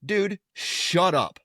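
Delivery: drawn-out, punched "shut up"; exasperated, slow put-down; mock scolding. Dad scolding his friend's son, exasperated.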